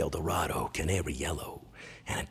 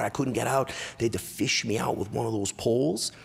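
normal talking voice

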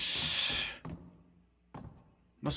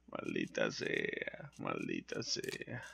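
much lower, softer, slow whispered maldita sea; whispered curse; 15 dB quieter, much slower than usual. Real, not acted. Gamer cursing quietly in a tense moment, slow whisper. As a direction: hiss the curse under breath.

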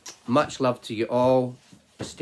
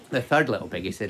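ordinary delivery

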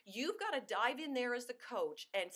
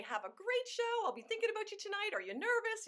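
cheery pep call; 11 semitones higher than usual. Shown as a demonstration. Consultant calling her hostess before the show, high cheery pep. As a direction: gush encouragement at the hostess.

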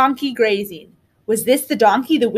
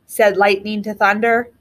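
usual speaking voice

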